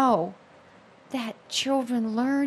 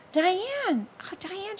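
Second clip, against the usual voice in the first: delighted recognition; 10 semitones higher, much slower than usual. Retold or imitated. Old blind nun recognizing a former pupil, high and delighted. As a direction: rise up in joyful surprise at the name.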